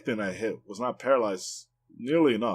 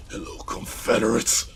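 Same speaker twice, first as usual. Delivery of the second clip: slow raspy greeting, dripping with contempt; imitating a pissed-off NPC. Streamer imitating the game NPC's greeting, slow raspy and pissed off. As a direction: growl out the hello like you hate them. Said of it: "'cause he sounds so fucking pissed off".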